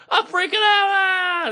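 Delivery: high-pitched hammed-up panic; mock freakout. Comedian mocking what askers want to hear, hammed-up panic. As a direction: play the frantic guest people want. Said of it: "they want you to be like".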